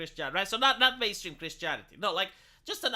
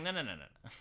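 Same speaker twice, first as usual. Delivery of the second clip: mock corporate refusal; 7 semitones lower, 8 dB quieter, much faster than usual. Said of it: "Blizzard goes like".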